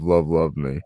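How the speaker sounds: usual speaking voice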